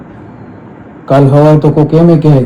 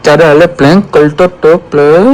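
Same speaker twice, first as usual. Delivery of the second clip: mock baby voice, teasing; playful baby talk; 3 semitones higher than usual. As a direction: coax like talking to a toddler. Teasing partner after prank reveal.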